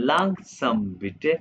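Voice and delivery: langsam bitte drawn out very slowly; slow demo. Shown as a demonstration. Teacher models asking to slow down, very slowly. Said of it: "आपने मुझे कहना है".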